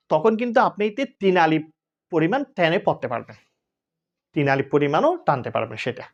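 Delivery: ordinary talk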